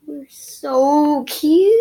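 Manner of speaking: high, loud, slow gush; delighted fangirl gush. Real, not acted. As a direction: squeal over the scene. Gushing after reading scene.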